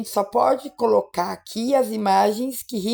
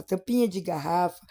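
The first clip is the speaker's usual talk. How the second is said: ordinary delivery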